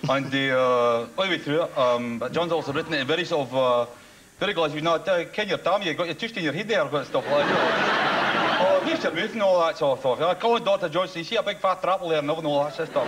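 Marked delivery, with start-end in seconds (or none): 4.4-13.1 laughing broad Glaswegian accent imitation. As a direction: rattle it off in thick Glaswegian, laughing. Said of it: "he's got a Glaswegian accent like this".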